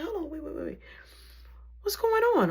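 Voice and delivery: high, hurried imitation of the principal; flustered principal. Principal interrupting assistant's call for security, high and flustered. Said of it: "So the principal like".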